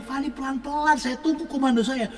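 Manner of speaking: a mock-urgent call to wait; alarmed urging. Preacher urgently retells stopping girl.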